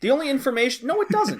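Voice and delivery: sharp high-pitched no, it doesn't; emphatic denial. Real, not acted. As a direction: shoot the voice way up in protest. Heated disagreement over the game.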